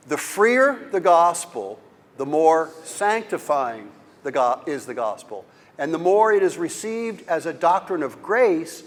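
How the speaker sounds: solemn reading aloud. Acted. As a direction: read it like scripture to the class. Reads a quote aloud.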